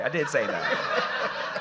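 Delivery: slow, laughing through the words; laughing admission. Real, not acted. Speaker laughing as he admits teasing a boy, slowly. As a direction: own it with a guilty laugh.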